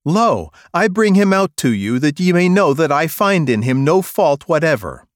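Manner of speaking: loud high governor's declaration; Pilate proclaiming. Acted. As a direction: announce it to the crowd. Pilate addresses the crowd.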